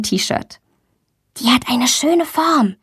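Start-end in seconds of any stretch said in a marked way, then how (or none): none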